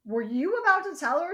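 mock angry Curtis pulling her aside; demanding. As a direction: confront her sharply aside. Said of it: "he pulls Jordan aside".

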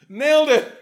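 high, loud laughing cheer; mock triumph. Imagined writer celebrating, loud laughing cheer. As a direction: crow it through laughter. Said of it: "It's like".

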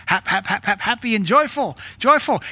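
lampoon the fake joy, climbing in pitch; mock forced cheer. Preacher lampooning the movement's facade, rising mock cheer.

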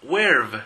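where've said as one clipped syllable weɾv; crisp teacher model of where've; where've, loud and falling; model pronunciation. Shown as a demonstration. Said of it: "Esto es muy común".

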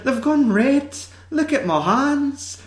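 high, overdone fake Scottish whine; mock a badly done Scottish accent, very high. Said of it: "bad Scottish accents".

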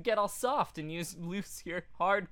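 usual speaking voice

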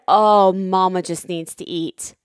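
low, slow mock-soothing; imitating husband. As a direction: mimic his patronizing calm. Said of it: "And then he's like".

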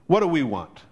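ordinary talk, nothing marked

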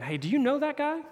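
lightly voiced, high-pitched questioner; curious questioner. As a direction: ask it high, pitch rising as a bystander would. Third accuser questions Peter.

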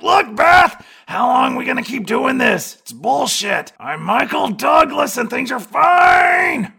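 high, loud hammed-up shout; Michael Douglas impression. Detective ranting at Beth in her office, loud mock shout. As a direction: rant it like a frantic cop. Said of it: "he's like".

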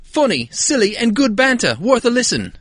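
high, loud mock-English accent. Co-host reads a listener's five-star review, English accent. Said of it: "doing this in an English accent".

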